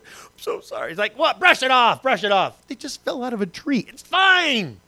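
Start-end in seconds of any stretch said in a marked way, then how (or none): 0.0-0.9 softer, whispered mock sympathy; comforting mom. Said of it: "Moms, you're the ones". 1.2-4.8 higher, louder mock-dismissive dad; gruff dad. Dad dismisses hurt kid.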